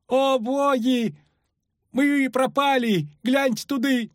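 high, drawn-out panicked wail; alarmed cry. A narrator's reading. Ferryman spots riders on the bank, alarmed high cry. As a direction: cry out in dismay, high and slow. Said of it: "крикнул паромщик, и отнюдь не радостно".

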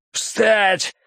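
high-pitched, strained order; barked command. A narrator's reading. Gunman orders captives to stand. (резкий приказ высоким голосом)

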